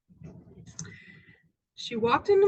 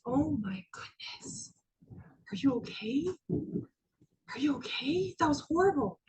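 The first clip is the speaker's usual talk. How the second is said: concerned colleague; 5 dB quieter than usual. Retold or imitated. Colleague checking in after the outburst, hushed concern. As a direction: check on them softly, aghast.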